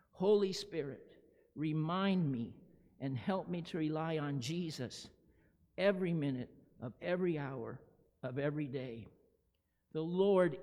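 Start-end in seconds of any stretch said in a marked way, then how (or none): 0.1-9.1 group prayer. Real, not acted. Prayer repeated together.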